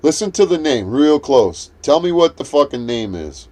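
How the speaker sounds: ordinary talk, nothing marked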